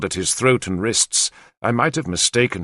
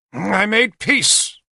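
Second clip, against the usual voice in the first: acid; 10 semitones higher, much slower than usual. Acted. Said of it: "he said acidly".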